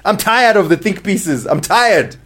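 high-pitched, fed-up complaint; exasperated rant. Real, not acted. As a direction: rant as if truly worn out by it. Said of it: "by ranting in this way".

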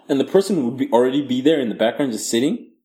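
ordinary talk

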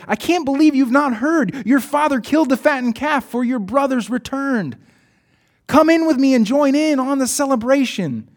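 a beaming servant spilling good news; excited servant. Acted. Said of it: "He smiled wide and said".

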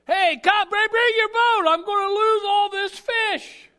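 high, full-volume call for help; urgent yell. Retold or imitated. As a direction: yell across the water to the other boat. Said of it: "and he yelled".

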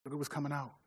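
father softly correcting his son; gentle dad. Retold or imitated. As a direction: quietly point the son to his heart. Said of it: "I tell my son".

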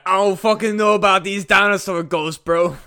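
a hint of a skeptical dude bro. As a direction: brush it off like a bored bro. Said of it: "just dude bros".

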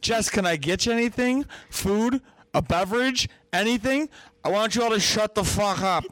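high, sugary offers of food then a snap; syrupy fake politeness; mock overly sweet host. Friend mocking how she fussed over drunk Jess, high and sugary. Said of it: "I was like".

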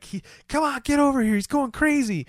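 very high mock-frantic calls; mock panicked calling. Friends calling him over, high mock panic. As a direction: squeal it like panicking friends, without shouting.

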